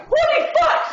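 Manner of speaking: loud, high astonished yell with a laugh; shocked exclamation. Retold or imitated. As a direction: shout it like you can't believe your eyes. Shopkeeper sees the crash.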